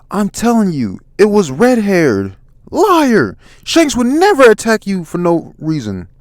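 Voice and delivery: acted shouting match, pitched high; heated argument. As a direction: argue back loudly and indignantly. Acted quarrel between giant and Luffy.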